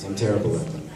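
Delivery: ordinary delivery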